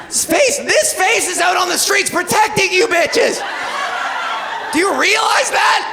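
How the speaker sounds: high, emphatic shouting; mock indignant rant. Comic to the ladies she protects, mock indignant shout. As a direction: holler it in hammed-up outrage.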